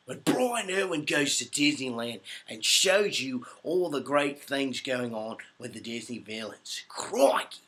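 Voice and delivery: put-on slow, showy Australian presenter accent; Steve Irwin. Showing fans his Halloween costume character, Steve Irwin-style Australian accent. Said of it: "Just like that".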